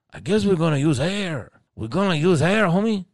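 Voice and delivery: a playful imitation of the vato. The vato dad joking about air balloons, mild mock voice. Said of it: "this hardcore vato".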